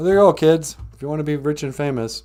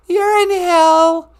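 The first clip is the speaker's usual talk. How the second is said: put-on very high, loud little-girl voice; squeaky girl caller; 15 dB louder, much slower than usual. Caller [name] greeting [name] in a very high girlish voice. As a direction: chirp a hello like an excited kid.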